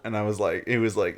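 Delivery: usual speaking voice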